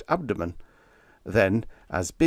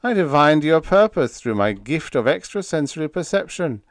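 self-satisfied boast, pitched up; preening; 7 semitones higher, 6 dB louder than usual. A narrator's reading. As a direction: preen over your own cleverness. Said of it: "he preened himself".

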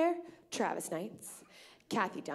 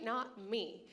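ordinary talk

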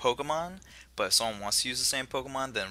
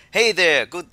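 loud, higher Jamaican greeting; Jamaican accent; 5 semitones higher, 9 dB louder, slower than usual. Acted. Host starts reading the game professor's welcome, Jamaican accent. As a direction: try out a Jamaican accent, loudly.

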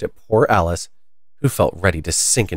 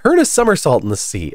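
excited; 6 semitones higher, 3 dB louder than usual. A narrator's reading. Gryphon still excited.

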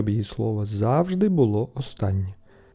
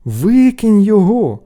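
slow, high, loud command; stretched, raised-voice command; exasperated order; 9 semitones higher, 9 dB louder, slower than usual. A narrator's reading. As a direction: order your brother firmly. Brothers order him.

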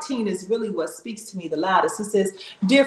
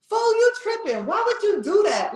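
much higher, exasperated rebuke to Peter; scolding Jesus; 11 semitones higher than usual. Acted. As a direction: scold him like a fed-up friend.

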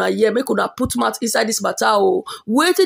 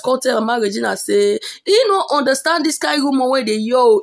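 ordinary talk

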